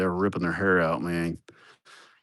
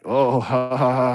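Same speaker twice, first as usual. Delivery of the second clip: a put-on mocking laugh, a bit higher; mock laughing crew; 4 semitones higher, slower than usual. Crew mocking the boss, put-on mocking laugh. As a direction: play the crew laughing at him. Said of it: "And they're like".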